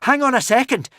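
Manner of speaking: high loud urgent call; crying out. A narrator's reading. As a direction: call out to stop everyone. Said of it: "cried Valhallarama".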